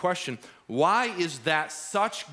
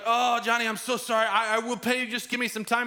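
high, apologetic begging; pleading friend; 8 semitones higher than usual. Retold or imitated. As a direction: beg for time, voice high and anxious.

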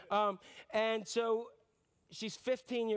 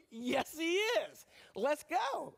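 higher, slow, ready-to-go answer; eager mock reply; 5 semitones higher, much slower than usual. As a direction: answer like you can't wait to start. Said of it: "I'll be like".

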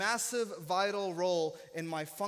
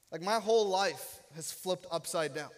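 normal talking voice

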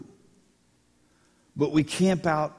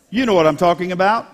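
ordinary talk, nothing marked

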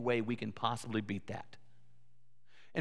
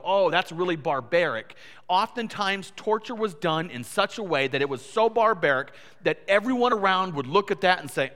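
louder, driving sermon delivery; raised voice, pressing the point home; forceful preaching; 3 semitones higher, 6 dB louder than usual. Real, not acted.

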